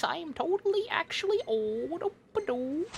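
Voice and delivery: put-on high, slow creaky old man. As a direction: play a doddering old man. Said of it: "Old man voice".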